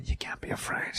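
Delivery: hushed, voiceless Walken; Walken whisper. Shown as a demonstration. Comic teaching Walken impression, sudden whisper. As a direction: drop right down into a whisper. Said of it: "go down into the whisper".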